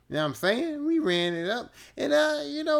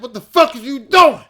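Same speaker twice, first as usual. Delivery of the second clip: much louder, higher furious shout; angry yell; 9 semitones higher, 16 dB louder than usual. Retold or imitated. Ramin yelling at Scarborough in the shower, furious loud shout. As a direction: bark it at him in the stall line. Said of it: "and he said".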